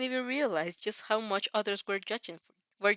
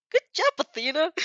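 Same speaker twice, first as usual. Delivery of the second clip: higher and louder, light teacher praise; pleased teacher; 8 semitones higher, 8 dB louder than usual. Acted. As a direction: praise her like a relieved teacher.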